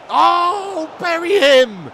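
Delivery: high, loud urging yell; will the striker to finish; urgent shout. Real, not acted. Streamer urging his player to bury the chance, urgent shout.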